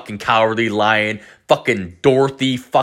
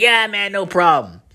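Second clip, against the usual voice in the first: easygoing coworker voice; 9 semitones higher than usual. Retold or imitated.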